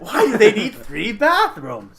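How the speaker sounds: loud, high, drawn-out incredulous laugh; laughing disbelief. Real, not acted. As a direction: exclaim it slowly through laughter. Laughing disbelief at another bathroom.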